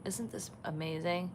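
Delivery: hushed, near-whispered wonder; soft hushed voice. Real, not acted. Watching giraffes at dawn, hushed ASMR-like whisper. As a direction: breathe it out softly, half whisper. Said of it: "Is this A S M R with [name]?".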